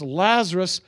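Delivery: acted shout to call someone forth; crying out. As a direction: cry it out as a summons. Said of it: "Jesus cries out and says".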